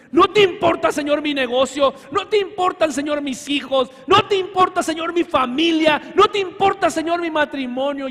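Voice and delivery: loud, high rising plea; mock complaint. Believers complaining to God.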